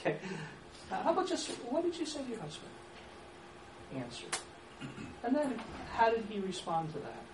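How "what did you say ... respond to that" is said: slow open questions with a pause for the answer; slow it down, one question at a time. Shown as a demonstration. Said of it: "You see the difference? Just slow it down".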